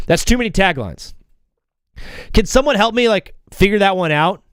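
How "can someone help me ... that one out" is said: normal talking voice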